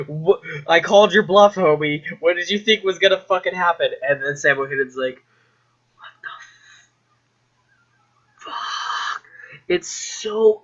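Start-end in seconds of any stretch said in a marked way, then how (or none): 0.1-3.9 loud, punchy taunt; mock cocky challenge. Doom Guy taunts the scientist after smashing it, mock cocky challenge. 6.0-9.2 voiceless, drawn-out mock shock; stunned disbelief. Scientist sees the last machine smashed, stunned mock whisper.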